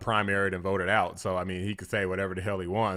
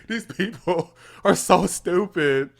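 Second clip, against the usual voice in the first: laughing; 6 semitones higher, 4 dB louder, slower than usual. Real, not acted. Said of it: "nothing you can do but laugh".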